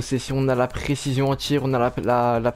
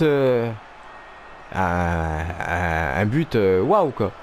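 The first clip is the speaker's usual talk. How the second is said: ordinary talk, nothing marked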